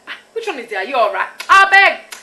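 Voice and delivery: high, loud dismissive "I beg"; African elder voice. Retold or imitated. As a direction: shrug it off like an elder. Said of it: "you'd hear other African people say it".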